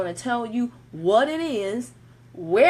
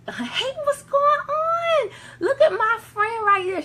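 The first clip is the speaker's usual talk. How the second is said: high, drawn-out squeal of welcome; excited greeting; 14 semitones higher, 4 dB louder, slower than usual. Real, not acted. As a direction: greet a friend with a delighted, high-pitched holler. Spots a friend joining the live.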